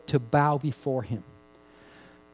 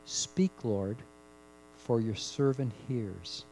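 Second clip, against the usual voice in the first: reverent hush; 4 semitones lower, 7 dB quieter, slower than usual. Retold or imitated. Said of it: "you say this".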